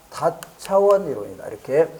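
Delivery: ordinary talk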